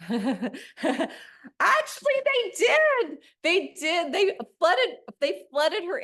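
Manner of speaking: bursting into laughter on the reveal; laughing. Real, not acted. Speaker delighting the audience with the surprise, laughing. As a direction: laugh through it, pitch jumping high and loud.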